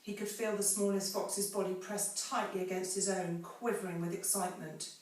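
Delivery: ordinary talk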